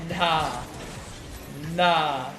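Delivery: ordinary talk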